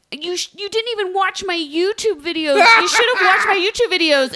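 high voice, laughing through it; mock her while cracking up; laughing mock Stephanie. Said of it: "Stephanie's like".

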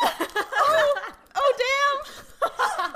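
very high, loud squeal with laughter; swooning reaction. Retold or imitated. Fan reliving a kiss scene for friends, squealing.

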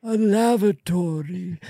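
proud genius pirate in an Irish lilt. Acted. Genius pirate announcing his invention in an Irish accent. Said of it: "coming from [name]'s voice".

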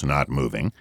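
ordinary delivery

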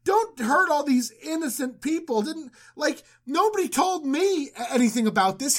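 high-pitched, flustered protest of innocence; mock pleading. Abimelech pleads with God not to destroy him, mock pleading. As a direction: beg your way out, hammed up. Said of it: "Abimelech pleads with God".

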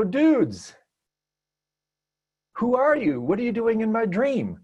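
high-pitched mock confrontation of the dudes; acted-out challenge. Lucid dreamer challenging gruff bar sailors in his dream, mock confrontation. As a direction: call them out, pitched well up, playfully indignant.

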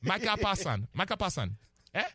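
put-on emphatic, playful Nigerian accent. Analyst urging three rushers to act as one, in Nigerian accent. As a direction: make the point in a Nigerian accent. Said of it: "the accent".

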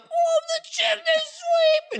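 very high, slow, wailing character voice; chimney sweep voice. Retold or imitated. Imitating Elise's chimney sweep character, high wail. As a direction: cry it out like a stage chimney sweep. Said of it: "her being like".

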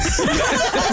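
squealing breathless laugh; high squeal of laughter. Real, not acted. Cohost squealing with laughter over not knowing baking.

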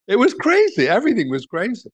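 high-pitched, incredulous delight; tickled by the absurdity, pitch soaring; amused exclamation. Real, not acted. Exclaiming over the absurd anecdote.

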